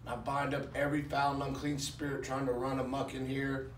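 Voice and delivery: soft, low, earnest prayer. Acted. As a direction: pray quietly over the church. Leads congregation prayer.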